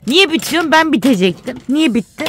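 high, loud teasing protest; half-joking loud whine; loud mock complaint. Real, not acted. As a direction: play-act being robbed of the win. Playful complaint over lost game.

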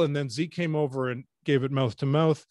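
normal talking voice

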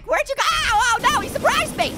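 high, alarmed yelping; startled cry. Real, not acted. Player is surprised by Shrek, startled cry. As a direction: yelp in sudden surprise, pitch way up.

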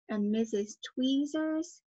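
ordinary delivery

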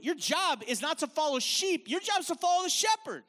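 rising preacher's call; emphatic. Real, not acted. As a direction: punch the contrast home. Preacher's climactic contrast.

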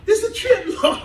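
high, wide-eyed disbelief to the Lord; mock amazed prayer. Pastor marveling to the Lord at his healing, high and amazed. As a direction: squeal it up high in wonder.

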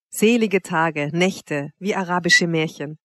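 plain reading voice

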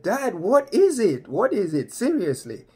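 imitating exasperated son. Son asking his laughing dad for the reason, loud mock exasperation. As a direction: start high and press for an answer.

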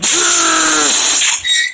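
high startled shouted gasp; loud shocked gasp. Real, not acted. Shocked by sudden event in game.

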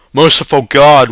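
loud, high distraught cry; whimpering. A narrator's reading. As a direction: appeal to heaven in distress. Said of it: "whimpered father".

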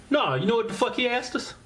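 normal talking voice

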